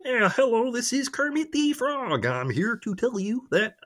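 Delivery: high, slow puppet frog voice; Kermit the Frog. Acted. For his co-host, doing Kermit on request, high and slow. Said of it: "I can do Kermit".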